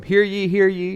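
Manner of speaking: a hint of a courtroom announcement; courtroom crier. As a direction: call it out like an old courtroom crier. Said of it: "think about the old courtroom".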